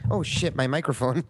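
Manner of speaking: ordinary talk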